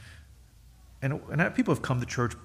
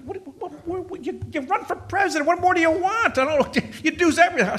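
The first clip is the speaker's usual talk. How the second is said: high-pitched, stammering exasperation building louder; exasperated retort; 14 semitones higher, 4 dB louder than usual. Acted. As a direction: sputter in mock disbelief, voice shooting up high. Exasperated retort to complaint about progress.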